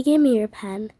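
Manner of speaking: normal talking voice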